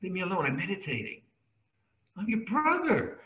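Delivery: a hammed-up monk shooing everyone away; mock precious monk. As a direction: play a touchy monk guarding his meditation. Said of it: "monks get that way sometimes".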